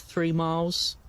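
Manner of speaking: ordinary talk, nothing marked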